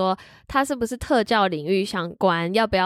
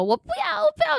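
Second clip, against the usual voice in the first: put-on high wailing child's refusal; mock a kid throwing a tantrum; imitating a screaming kid. Teacher imitating the child's tantrum, high wailing refusal. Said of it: "然后开始狂吼".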